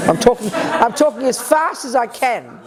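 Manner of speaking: laughing while talking. Real, not acted. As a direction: laugh through the words. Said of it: "Give me a break, Uzi".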